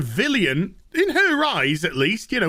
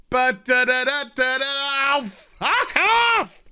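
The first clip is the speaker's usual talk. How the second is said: mock exasperated outburst; 6 semitones higher than usual. Mocking a character's exasperation.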